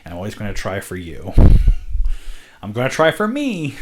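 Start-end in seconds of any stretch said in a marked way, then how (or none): 2.6-3.8 excited. Real, not acted. After laugh, jumps high.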